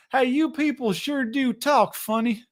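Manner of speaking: high-pitched teasing call; mocking. Navy sailor teasing foreigners about their speech, mocking. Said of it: "as I'm making fun of".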